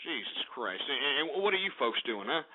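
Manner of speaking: low, soft exasperated mutter; muttered aside. Real, not acted. Radio host turning to his listeners, muttering low and soft. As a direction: sigh it out under the breath.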